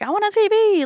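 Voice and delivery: put-on high, begging like a greedy kid; whiny kid voice. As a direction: whine it like a spoiled child. Kids begging for a TV.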